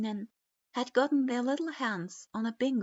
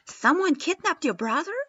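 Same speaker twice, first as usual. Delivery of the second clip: high, loud disbelief; shocked; 7 semitones higher, 10 dB louder than usual. A narrator's reading. Naruto reacting in shock to the kidnapping, loud and high. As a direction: gasp it out in shock.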